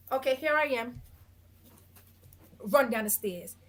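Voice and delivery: ordinary talk, nothing marked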